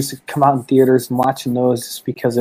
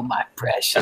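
low, soft creature voice; Gollum; 4 semitones lower, 10 dB quieter than usual. Retold or imitated. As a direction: lightly imitate a creature hoarding its treasure. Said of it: "you gotta be Gollum for Halloween".

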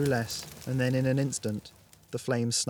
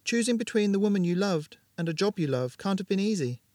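a clearly higher woman's voice, understanding; Ramona, sympathetic; 7 semitones higher than usual. Acted. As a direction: show her you understand his loss. Ramona speaks to Jared.

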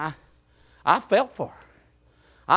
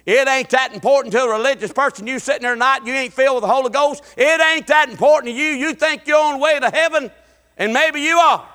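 preaching at full force; 5 semitones higher, 4 dB louder than usual. Acted. Preacher building up.

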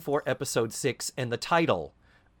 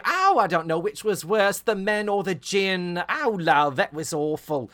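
loud, higher Cockney dame; old Cockney; 8 semitones higher, 5 dB louder than usual. Retold or imitated. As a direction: ham up the old Cockney talk. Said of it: "she's doing the old talking Cockney".

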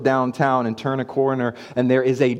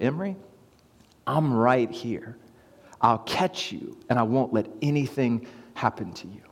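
a dad quietly promising to catch her; gentle reassurance; 2 semitones lower, 7 dB quieter than usual. Retold or imitated. Dad softly reassuring his scared daughter at the boat edge, tender.

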